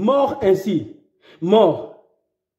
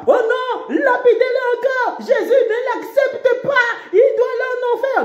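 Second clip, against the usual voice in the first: mock judgmental believer. Preacher mocking believers who condemn sinners, pitched high.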